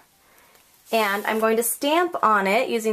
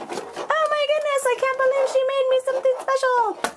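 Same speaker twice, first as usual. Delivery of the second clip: much higher, thrilled squeak; gushing friend. Retold or imitated. Friend opening the homemade gift, high thrilled squeak. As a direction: gush like a delighted friend.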